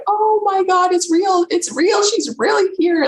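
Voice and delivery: a rush of stunned, delighted excitement; excited disbelief. Retold or imitated. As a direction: burst out high with amazed joy. Retold moment of realization.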